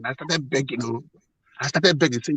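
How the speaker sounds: ordinary talk, nothing marked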